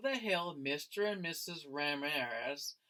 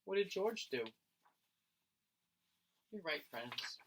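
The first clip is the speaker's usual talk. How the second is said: ordinary delivery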